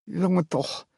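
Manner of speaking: a fond sigh through a numb mouth; lisping mother. A narrator's reading. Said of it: "lispelde het hert".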